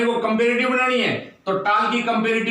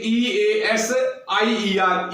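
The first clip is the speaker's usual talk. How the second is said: slow spelling; much slower than usual. Shown as a demonstration. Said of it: "Y को आई में change करके ER लगा देंगे".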